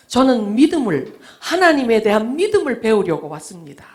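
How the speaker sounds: earnest speech. Retold or imitated. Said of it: "말을 좀 해달라".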